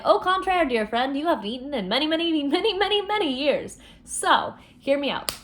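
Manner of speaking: high, grandiose mock-posh flourish; over-the-top mock elegance; theatrical Jax. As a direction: stretch the many, many like a showman. Jax's theatrical mock persuasion.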